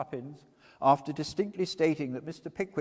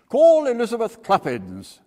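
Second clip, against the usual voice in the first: loud courtroom call; 11 semitones higher, 15 dB louder than usual. A narrator's reading. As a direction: call the witness loud and high. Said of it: "said Sergeant Buzfuz".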